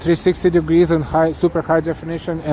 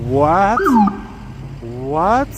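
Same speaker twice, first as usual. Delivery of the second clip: can't believe the donation; stunned what; 5 semitones higher than usual. Real, not acted. Huge super chat appears.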